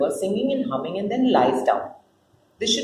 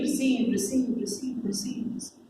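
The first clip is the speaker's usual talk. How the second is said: each repeat softer until it's a whisper; fading echo; 9 dB quieter than usual. Acted. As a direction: let the word die away like an echo.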